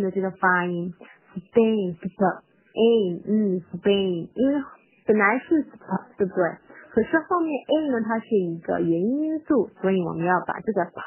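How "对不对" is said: ordinary talk, nothing marked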